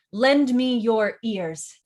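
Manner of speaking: "lend me your ears" as four loud, slow beats; all syllables stressed. Shown as a demonstration. Teacher shows the stresses, "lend me your ears" all punched slowly. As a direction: hit every word of "lend me your ears" equally. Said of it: "and all stressed".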